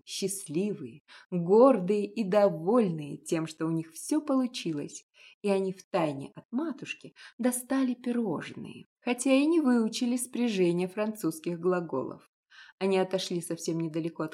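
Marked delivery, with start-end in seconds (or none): none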